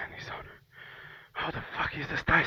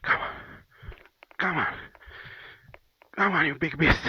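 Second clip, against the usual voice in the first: grunting effort, getting louder; straining effort, building louder; 5 semitones higher, 5 dB louder than usual. Real, not acted. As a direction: push out each come on harder. Straining to push heavy bike.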